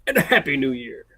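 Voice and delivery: big jolly Santa laugh. Pretend. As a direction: boom out a hearty Santa greeting. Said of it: "come on, a little more enthusiasm".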